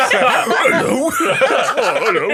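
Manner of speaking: different witch voice. Acted. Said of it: "she does a different voice".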